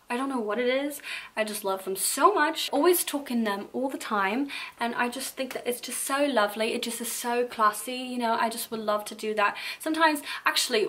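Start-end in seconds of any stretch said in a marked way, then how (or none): none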